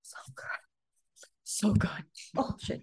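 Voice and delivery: breathy whisper of pure delight; whispered so good. Real, not acted. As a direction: breathe it out, savoring. Savoring food memory.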